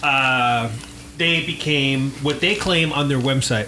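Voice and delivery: usual speaking voice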